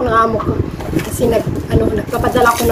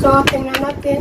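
ordinary talk, nothing marked

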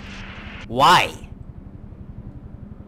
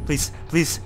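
ordinary talk, nothing marked

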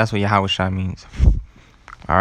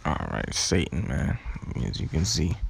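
muttered aside; 6 semitones lower, 10 dB quieter than usual. Real, not acted. Muttered aside about technical trouble.

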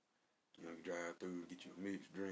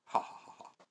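mock laugh. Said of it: "That tickled me, man, the way he said that".

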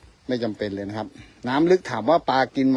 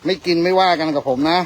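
louder, higher joking plea to the fish; playful plea; 6 semitones higher, 9 dB louder than usual. Real, not acted. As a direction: coax the fish teasingly, out loud.

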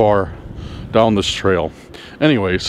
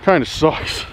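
winded from hiking in snow; breathless from the climb; out of breath; 5 dB quieter than usual. Real, not acted. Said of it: "Definitely getting a workout here".

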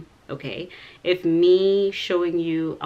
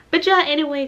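bright sudden squeal of a sign-off; burst of excitement; 10 semitones higher, 8 dB louder, faster than usual. Real, not acted. Sign-off to viewers, much higher and louder. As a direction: jump way up and loud on "anyway".

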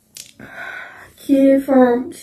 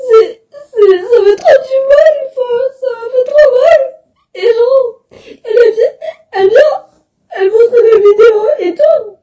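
higher, breaking into sobs; crying hard; 11 semitones higher than usual. Real, not acted. Recalling the teacher's insult in class, sobbing. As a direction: let the hurt spill over, voice cracking up high. Hurt peaks while recounting.

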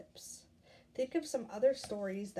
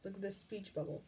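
usual speaking voice

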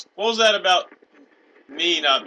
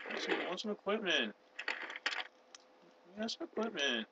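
high, softer whine; whining; 3 semitones higher, 11 dB quieter than usual. Pretend. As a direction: whine it like a pestering kid. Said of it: "Stop your whining".

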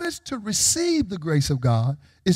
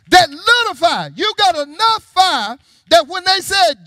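high, loud preacher's delivery; full-force preaching; 12 semitones higher, 8 dB louder than usual. Real, not acted. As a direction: preach it out to the congregation.

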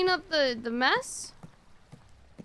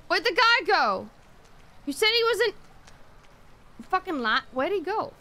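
louder, higher urgent calls; frantic calling out; 5 semitones higher, 3 dB louder than usual. Real, not acted. Bath attendant hunting the man, frantic calling out. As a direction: demand it, searching fast.

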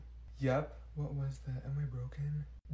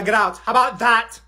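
angry order; 5 semitones higher, 6 dB louder than usual. Acted. Teacher sending student out.

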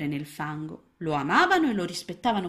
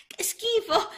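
very high, small frog voice; disgusted little frog; 5 dB louder, slower than usual. A narrator's reading. Little frog disgusted by the dry fly, high squeak.

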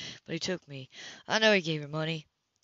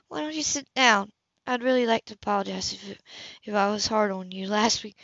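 higher, cool woman's voice, caught off guard; higher, cooler woman's voice to Cam at her door; Anna's voice; 4 semitones higher, 3 dB louder than usual. Acted. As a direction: play the composed woman surprised at her door. Anna apologizing.

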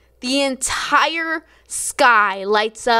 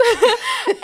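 teary laugh; 14 semitones higher, 3 dB louder than usual. Real, not acted. Laughs, almost crying.

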